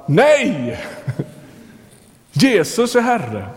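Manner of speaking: high, emphatic defiant answer with a laugh; defiant retort. Retold or imitated. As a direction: fire back defiantly, then slow down on the confession. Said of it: "Och de svarar". (trotsigt svar, högt och eftertryckligt)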